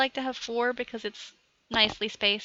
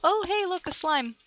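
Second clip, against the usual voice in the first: ordinary delivery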